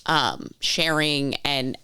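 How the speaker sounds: ordinary talk, nothing marked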